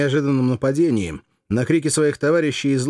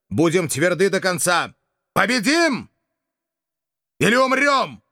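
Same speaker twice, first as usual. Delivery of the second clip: high, ringing battle speech; rally the troops, pitch soaring; roaring rallying speech; 9 semitones higher than usual. Acted. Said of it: "обратился к солдатам со следующей речью".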